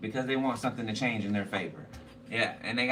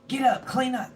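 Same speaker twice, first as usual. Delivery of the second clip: much higher, louder barking granny; mock bossy grandma; 12 semitones higher, 4 dB louder than usual. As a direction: yell the house awake as grandma. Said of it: "Waking everybody up".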